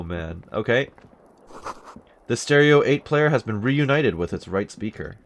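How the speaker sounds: normal talking voice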